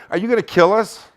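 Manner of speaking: scared question. Retold or imitated. Frightened friend in the car asking the narrator, panicked. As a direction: ask it like he fears for his life.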